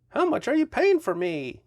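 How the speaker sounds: high, put-on voice for the toy; toy speaking up. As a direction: pipe up as the little figure. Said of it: "Wait, I'm sorry, it's Frankenstein".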